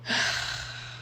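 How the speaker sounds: long, acted sigh of exhaustion; weary sigh. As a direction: let out a worn-out breath. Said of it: "He's too tired to care".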